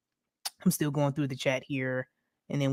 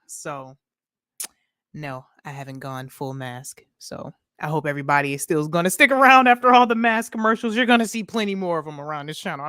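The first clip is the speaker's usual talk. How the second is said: normal talking voice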